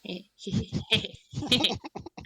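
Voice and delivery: long giggle falling away; drawn-out mischievous chuckle; gleeful giggle. Real, not acted. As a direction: giggle with relish at the plan. Relishing the grenade in mouth.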